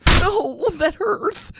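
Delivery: very high, loud yelp of pain; a little man kicked in the ribs; pained Floon. Acted.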